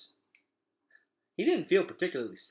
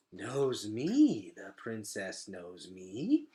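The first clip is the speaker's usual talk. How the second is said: a hint of a hushed, slow bug voice; lightly voiced roach, slowed in wonder; awed roach; 2 semitones lower, much slower than usual. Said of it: "said the roach in awe".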